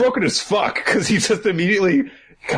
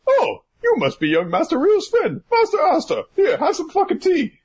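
high, overly polite butler; fussy butler voice; 12 semitones higher than usual. Acted. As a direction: gush like a prim servant. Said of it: "And he's like".

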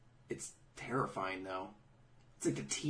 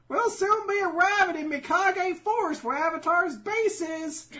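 very high, louder, cartoonish; mock high voice; 9 dB louder than usual. Recapping marching troops, very high cartoonish. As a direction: pipe it up like a chirpy soldier. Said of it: "They're like".

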